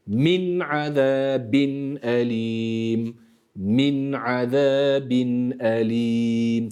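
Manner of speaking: Min azabin elim very slowly, each nun clear; slow, word by word. Shown as a demonstration. Tutor models the verse slowly, word by word. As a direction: tane tane, aheste, every sound held. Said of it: "Tane tane, yavaş yavaş".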